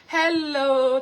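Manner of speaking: ordinary talk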